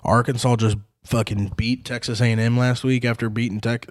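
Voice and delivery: ordinary talk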